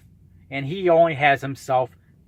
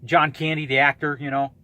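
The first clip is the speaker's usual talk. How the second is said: usual speaking voice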